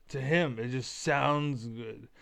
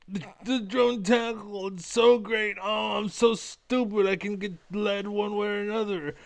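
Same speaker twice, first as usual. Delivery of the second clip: mocking impression; 6 semitones higher than usual. Mimicking a rival gloating about wasted workers, high mocking voice. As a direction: sneer it out as a gloating know-it-all. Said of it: "But when he does it".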